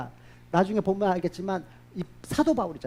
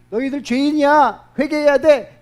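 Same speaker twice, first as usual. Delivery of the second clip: louder, slow, weighty reproach; stern rebuke; 6 semitones higher, 9 dB louder than usual. Acted. Jesus rebuking the religious leaders, slow loud reproach. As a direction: call them to repent, slowly and loudly.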